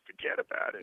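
whispered "Forget about it" in a mock New York accent; hushed, hammed-up New York accent. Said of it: "I gotta say".

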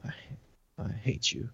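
very soft, barely voiced inner thought; silent thought. Inmate passing the officer, hushed private hatred. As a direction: let it drop almost to nothing, as if only thinking it. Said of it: "I'm thinking that".

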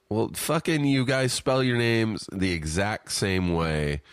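fed-up, comic outburst; exasperated venting. Real, not acted. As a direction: vent your frustration at your friends. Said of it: "I just really vented".

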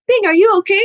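high-pitched imitation of motherly alarm; mock anxious checking on someone; worried mom. Mom checks on her injured daughter, worried.

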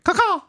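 high squawking caw like a hawk; chirping bird call. Pretend. Battlehawks mascot bird call.

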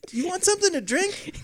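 high, quick put-on old lady voice; weird aunt voice. Aunt offering a drink, in a high put-on voice.

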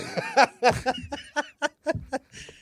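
loud, high laughter; high burst of laughter. Real, not acted. Cracking up at a friend's joke, loud high laugh.

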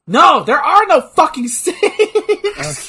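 high, loud, drawn-out exclamation; exasperated outburst. Real, not acted. Correcting a friend's guess about the stakes, exasperated outburst. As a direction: burst out in loud disbelief.

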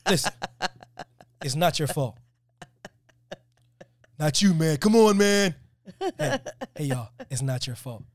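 pleading, building to a loud "not you, man"; half-serious Good Will Hunting comfort, swelling to a shout; Robin Williams therapist. Retold or imitated. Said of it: "I'm Robin Williams in Good Will Hunting".

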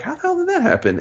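squeaky high mock disbelief; high, squeaky, baffled quote; mock bafflement. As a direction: pitch it way up, flabbergasted. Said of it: "but they're like".

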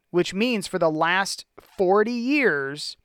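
ordinary talk, nothing marked

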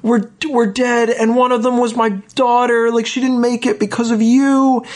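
high-pitched wailing accusation, acted out; mock crying outburst. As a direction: imitate a distraught wife crying out in accusation. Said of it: "she gets up and she's crying".

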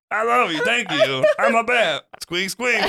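high squeaky bat voice; squeaky rubbery little voice; rubbery high squeaky toy. Acted. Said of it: "Oh, I'll try that".

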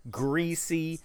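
slow, relishing host pitch; hype host. Acted. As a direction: savor every adjective like an ad. Hyping fries in show voice.

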